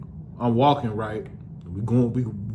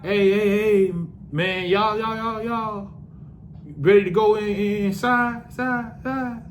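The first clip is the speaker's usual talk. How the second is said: higher, warped echoing call fading out; friends' voices echoing in a high haze; distorted echo; 7 semitones higher than usual. Retold or imitated. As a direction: stretch and warp it like a stoned echo. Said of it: "that's how I'm hearing it".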